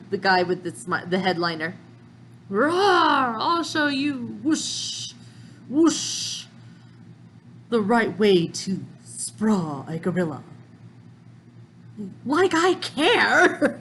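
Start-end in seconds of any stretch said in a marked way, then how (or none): 2.5-10.4 gorilla villain. Acted. 12.2-13.8 sneering. Acted. Sanji sneering.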